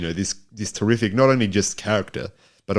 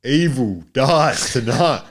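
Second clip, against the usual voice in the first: slow, dramatic trailer line; movie trailer voice; 5 semitones higher, 4 dB louder, much slower than usual. Acted. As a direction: deliver it like a horror trailer. Dramatic movie tagline quote.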